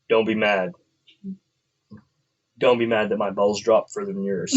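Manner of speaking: imitating own deep nighttime voice, low and softened; deep, low, deadpan boast. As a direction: drop way down into a deep bass. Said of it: "in my very deep voice, which I will try to imitate again".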